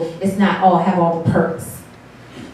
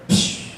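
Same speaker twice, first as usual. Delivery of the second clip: soft hissed "psh" of a cap popping open; imitated gas-cap release; 5 dB quieter than usual. Comedian imitating the car's fuel door opening, hissed noise. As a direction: let out a short rush of air. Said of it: "y'all, it said".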